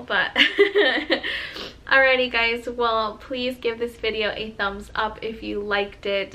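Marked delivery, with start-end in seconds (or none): 0.4-1.8 teary laugh. Real, not acted. Laughs at getting emotional.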